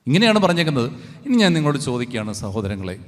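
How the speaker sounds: ordinary delivery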